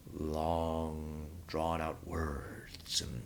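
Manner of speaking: very slow, every word stretched long; drawn-out preacher talk. Shown as a demonstration. Mocking a reverend who stirs up crowds, very slow stretched words. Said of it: "talking like this".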